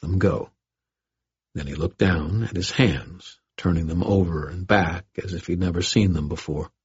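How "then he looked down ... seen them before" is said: ordinary delivery, nothing marked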